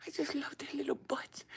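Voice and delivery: high, softened, airy squeak. Real, not acted. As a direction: coo high and soft over something cute. Gushing over cuteness.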